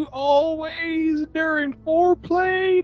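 very high, sustained tearful whine; mock weeping wail. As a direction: keep up the parody widow's sobbing voice. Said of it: "crying her eyes out".

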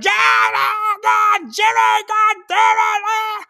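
put-on screaming high and loud at the kid on court; hammed-up furious sideline yell; mock yelling sports parent. Sports mom screaming at her kid Jimmy, hammed-up shout. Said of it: "They're like".

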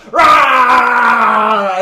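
loud roaring monster; dinosaur roar. Acted. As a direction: roar like a bursting beast. Monster bursts out.

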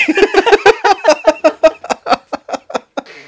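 long, high, loud laughing fit; uproarious laughter. Real, not acted. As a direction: burst out laughing helplessly. Cracking up after being teased.

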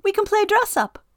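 very high, playful wind voice. A narrator's reading. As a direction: tease her like a mischievous breeze. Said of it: "Wind said".

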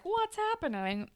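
high-pitched, softly voiced puzzled question; mock bewilderment. Jimmy after the paint is thrown, mock bewilderment. Acts out character doused with paint.

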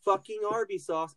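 ordinary talk, nothing marked

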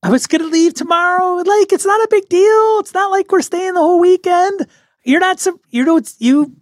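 high-pitched, wounded insistence; defensive protest. Acted. Eloise protests to her reluctant friend, defensive. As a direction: plead high that it is no big deal.

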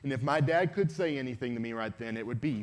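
normal talking voice

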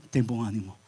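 Jesus reassuring the beggar, calm; calm reassurance. Retold or imitated. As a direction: answer gently and steady. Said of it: "calm answer to complaint".

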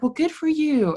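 high, snide mock retort; mocking snotty reply. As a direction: sneer it back sarcastically. Mimicking neighbor's snide retort.

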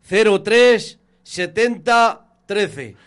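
slow, deliberate number dictation. Real, not acted. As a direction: read each digit out slowly. Dictating phone number slowly.

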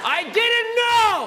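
high, loud, slow wail; anguished shout. Real, not acted. Shocked at own creepy voice.

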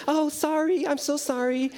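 high, sugary, forced-polite sorry; an overly sweet apology masking anger; fake sweet apology. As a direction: smile through clenched frustration. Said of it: "trying to keep a smile on her face when inside she's boiling up".

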